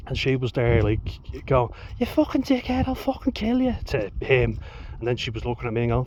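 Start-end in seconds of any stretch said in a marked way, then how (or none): 2.0-3.8 high-pitched mock Liverpool woman's rant; Scouse woman impression. Missus raging at her fella, high mock Scouse screech. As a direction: do the missus in a bad Scouse accent, much higher. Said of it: "a few bad accents in there".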